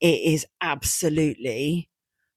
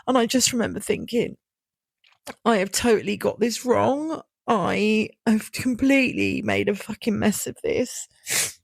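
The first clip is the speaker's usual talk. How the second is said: high, tearful voice on the edge of crying; holding back real tears; emotional; 4 semitones higher than usual. Real, not acted. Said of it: "God, sorry, I wasn't expecting this one to be this emotional".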